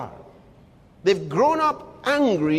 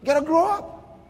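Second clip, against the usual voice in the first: much higher, pleading repeat; raised exhortation; 7 semitones higher than usual. Real, not acted. Preacher pressing the congregation again, pitched high. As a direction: lift the repeat up high, urging. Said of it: "repeated exhortation, second take raised".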